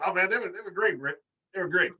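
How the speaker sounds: gushing reply. Retold or imitated. Answers as Tony in imagined chat.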